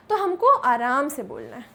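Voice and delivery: usual speaking voice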